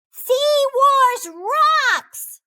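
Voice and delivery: high excited shout that fades; a kid lost in play, shouting with glee; crying out. A narrator's reading. Clark plays alone with Joey's new toy, a high excited shout. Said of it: "cried Clark".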